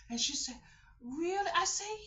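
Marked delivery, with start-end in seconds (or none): 1.0-1.5 put-on high little-girl question; high child asking really. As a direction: squeak it like a small girl. Child's voice voiced.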